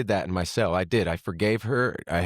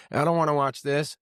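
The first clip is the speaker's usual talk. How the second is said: imitating mom; 7 semitones higher than usual. Voicing mom refusing the news.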